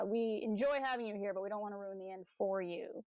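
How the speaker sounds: ordinary talk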